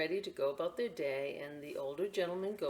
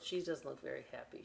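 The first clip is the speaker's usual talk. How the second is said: ordinary delivery, nothing marked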